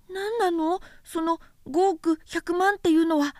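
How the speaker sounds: put-on light, high boyish voice, curious; a small boy asking innocently; little prince voice. A narrator's reading. Little prince asking the vain man, high childlike voice.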